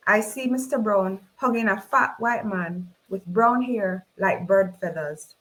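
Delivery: usual speaking voice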